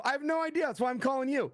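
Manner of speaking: high-pitched, mock-helpless comeback; retold helpless protest. Stuck author phoning his friend, comically helpless. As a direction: throw it up high, playfully clueless.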